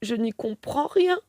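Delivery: stuttering, confused rising plea; bewildered stammer. A narrator's reading. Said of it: "bredouille Juanito".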